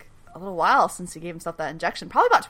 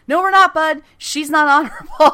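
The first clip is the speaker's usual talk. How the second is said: mock retort; 7 semitones higher, 6 dB louder than usual. Rebuttal voiced in retold quarrel.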